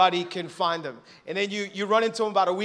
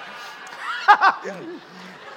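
breathy high laugh; airy laugh; 11 semitones higher, 3 dB louder than usual. Real, not acted. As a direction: let a quick airy laugh out. Laughs at his own joke.

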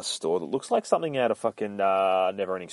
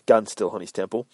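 ordinary delivery, nothing marked

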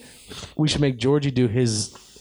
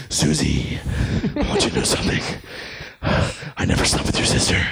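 Batman voice; 6 semitones higher than usual. Pretend. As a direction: growl the lines like a brooding Dark Knight, then explode. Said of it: "do the Batman voice".

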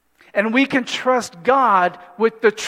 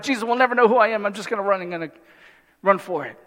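panicked escape plan; much faster than usual. Retold or imitated. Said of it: "And you were like".

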